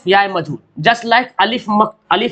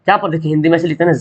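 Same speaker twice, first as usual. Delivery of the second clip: normal talking voice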